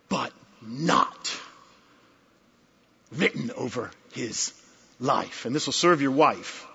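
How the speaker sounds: quiet after the shout; sudden hush. Real, not acted. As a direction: drop to a near-hushed aside.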